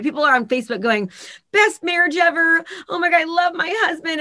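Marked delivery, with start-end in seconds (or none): 1.5-4.2 mock gushing. Mocking fake Facebook posts.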